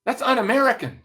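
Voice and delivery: high, mock-shocked assistant; mock outrage. Assistant scolding her boss for never watching Friends, mock outrage. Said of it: "She looked at me, she goes".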